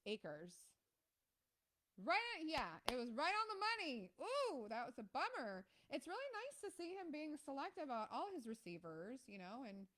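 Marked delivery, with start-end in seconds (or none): 2.0-9.2 excited. Real, not acted. Excited live game commentary.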